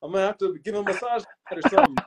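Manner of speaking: high-pitched, giggly joking; laughing. Real, not acted. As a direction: crack up while telling it, voice way up high. Joking about owing a friend.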